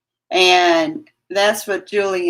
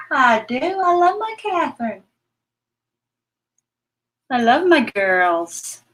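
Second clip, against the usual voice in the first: high loving coo to the pets; 5 semitones higher than usual. Real, not acted. Owner cooing love to her pets, high and tender. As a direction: pour love over them in a high coo. Telling pets she loves them.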